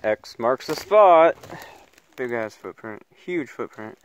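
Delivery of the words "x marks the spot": big slow proclamation; loud and slow. Real, not acted. Excited find in the woods.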